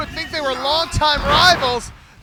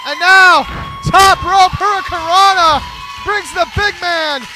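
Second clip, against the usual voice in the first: loud, high play-by-play call; excited call; 7 semitones higher, 9 dB louder than usual. Real, not acted. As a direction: shout the big move out. Live call of a big move.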